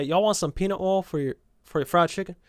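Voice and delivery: showing off a Southern accent; playful Southern demo question. Shown as a demonstration. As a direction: lean into the Southern accent as you offer. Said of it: "That's very Southern".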